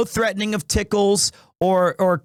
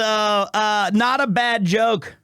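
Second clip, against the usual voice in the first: ordinary talk, nothing marked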